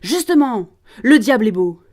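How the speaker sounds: beside himself, proclaiming. Acted. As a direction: proclaim it in a fury. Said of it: "proclame, dressé hors de lui". (proclamer hors de soi)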